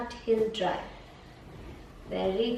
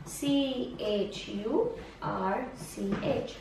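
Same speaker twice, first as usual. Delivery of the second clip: each letter slow and softer, spelled out; slow spelling; 3 semitones lower, 6 dB quieter, much slower than usual. Shown as a demonstration. Tutor spells church to the child, slow and softer. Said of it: "Church is spelled".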